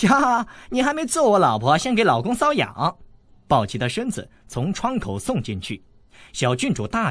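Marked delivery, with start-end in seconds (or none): 0.1-3.0 put-on high, amused cheeky boy; laughing tease. A narrator's reading. Slapped by the weak girl, the boy jokes back, amused. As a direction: laugh off her slap as a tickle. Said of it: "韦小宝笑道".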